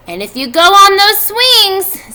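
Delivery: high, loud, slow motherly warning; worried mother. A narrator's reading. Mrs. Arable warning her children at the fair, anxious. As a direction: fuss over the kids like an anxious mom. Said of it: "said Missus Arable".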